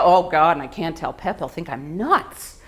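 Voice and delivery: ordinary delivery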